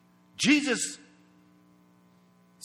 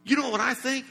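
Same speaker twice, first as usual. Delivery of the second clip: ordinary talk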